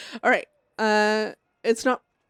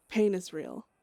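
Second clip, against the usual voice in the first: ordinary talk, nothing marked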